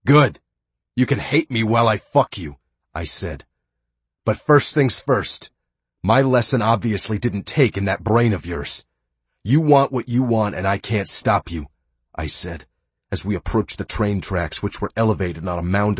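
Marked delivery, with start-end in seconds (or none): 0.0-11.7 gloating menace. Acted. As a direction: relish every threat. Gloating captor taunts.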